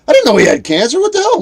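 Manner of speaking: retold shocked outburst, very high and loud; loud, high-pitched disbelief. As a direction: act out being floored by bad news. His shock at cancer news, retold.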